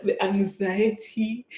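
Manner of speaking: mildly dramatic, slow lament; retold anxiety. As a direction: stretch it out, lightly dramatizing the nerves. Retelling nerves before going live.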